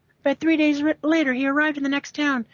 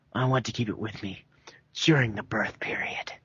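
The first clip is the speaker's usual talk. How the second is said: low villain voice; 6 dB quieter than usual. Acted. David answers, low and hushed.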